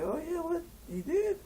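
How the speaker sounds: very high-pitched mock surprise; startled disbelief. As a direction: act out sudden realization, voice leaping up. Friend startled by news, acted.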